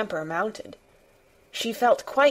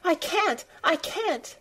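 very high, helpless little plea; boy's tearful, pitched-up protest; plaintive child, high and without force; gasping sobs; 10 semitones higher than usual. A narrator's reading. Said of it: "he gasped and sobbed".

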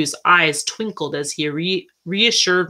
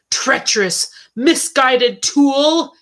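slow, higher-pitched indignant outburst; angry shout; 7 semitones higher, 4 dB louder, slower than usual. A narrator's reading. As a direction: hurl it out in anger, drawn out. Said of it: "he shouted".

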